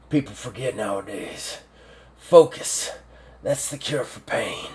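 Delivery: lightly voiced hard-bitten general; a touch of grim soldier, talking through the pain; stoic old general. Stoic general stitching his own wound.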